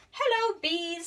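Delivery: bright, high royal hello; queen's greeting. Acted. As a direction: greet the class like a cheerful queen. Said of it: "said the queen".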